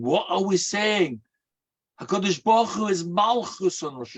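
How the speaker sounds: high, fervent preacher's voice; preaching at full force. Acted. Rabbi preaching to the congregation, high and fervent.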